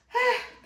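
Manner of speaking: sharp high exasperated sigh; loud high sigh. Acted. Storyteller sighs loudly before the next day, high and theatrical. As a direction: heave a big theatrical sigh.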